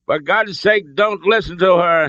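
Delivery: high-pitched, exasperated roar. A narrator's reading. Father drowns out the hostage's plea, roaring. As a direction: roar to override her. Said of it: "Henry roared at his son".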